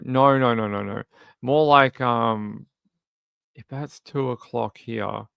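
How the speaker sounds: ordinary talk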